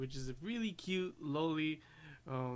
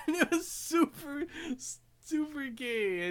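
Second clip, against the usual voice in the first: high, laughing through the words; real laughter breaking up the phrase, slowing down; 12 semitones higher, 4 dB louder than usual. Real, not acted. Fan retelling an absurd anime joke, laughing.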